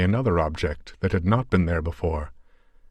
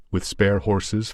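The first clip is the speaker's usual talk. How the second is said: usual speaking voice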